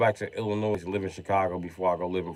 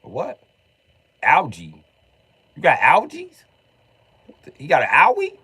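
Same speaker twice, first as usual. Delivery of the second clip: high-voiced mock bafflement; clueless Floridian; 6 semitones higher, 3 dB louder than usual. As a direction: question it like someone who never heard the word. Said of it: "They're like".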